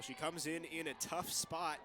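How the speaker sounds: usual speaking voice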